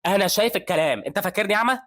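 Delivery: high, loud offended snap; angry retort. A narrator's reading. Professor snapping at the resident, high and loud. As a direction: bite back, insulted. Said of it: "بيتعصب هان وبيقول له".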